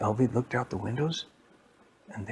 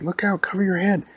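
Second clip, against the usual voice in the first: higher, louder acted shout of warning; urgent warning; 9 semitones higher, 7 dB louder than usual. Character warning a companion as rockets fly, urgent shout. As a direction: call out to duck for cover.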